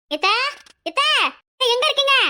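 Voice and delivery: high-pitched call through the house. Acted. Daughter-in-law looking for her mother-in-law, calling out. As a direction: call out, searching for someone.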